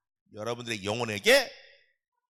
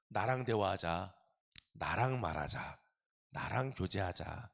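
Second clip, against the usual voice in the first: gentle invitation; 8 semitones lower, 10 dB quieter, slower than usual. Acted. God's gentle invitation voiced.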